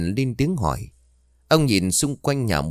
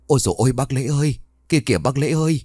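higher, fast, panicked calling out; frightened cry; 5 semitones higher, much faster than usual. Acted. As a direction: cry out in sudden terror, voice racing up. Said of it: "bà Hồng rú lên". (hốt hoảng kêu lên, giọng cao và nhanh)

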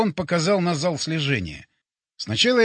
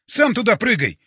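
loud, higher indignant retort; yelled; 5 semitones higher, 5 dB louder than usual. A narrator's reading. Hero refuses Боря's order to jump, angry yell. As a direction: throw the order back, yelling. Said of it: "заорал я".

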